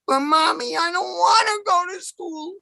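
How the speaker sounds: put-on high, whining child voice; whiny kid. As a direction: beg mommy like a sulky little boy. Whining kid begging his mother.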